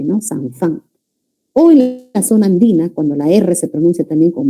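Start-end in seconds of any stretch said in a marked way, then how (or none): 0.2-0.8 "Zanfán" with an F where the J goes; jungle-region "Zanfán", the J sound turned into F. Shown as a demonstration. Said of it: "En lugar de decir la J con la F".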